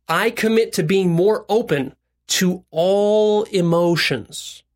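very slow, phrase-by-phrase pledge; deliberate recitation. Shown as a demonstration. Coach modelling a commitment for listeners, deliberate recitation.

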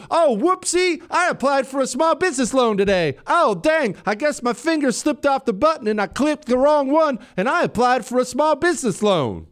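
mock someone claiming it all happened by accident; mock accidental applicant. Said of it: "could just kind of fall into".

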